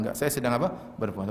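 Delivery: softly decline, unbothered; calm quiet refusal. Retold or imitated. Preacher voicing a fasting believer who is being insulted, quiet calm refusal. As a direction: say it gently and calmly, no heat. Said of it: "kita bilang".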